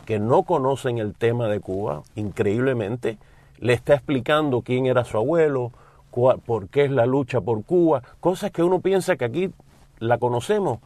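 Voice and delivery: usual speaking voice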